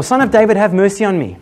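high, loud imitated plea; retold cry. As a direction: cry out over the crowd, acting it. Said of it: "he cried out all the more".

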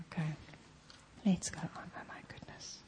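murmured under the breath to herself; whispered aside. Real, not acted. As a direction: drop to a whisper, not meant for the room.

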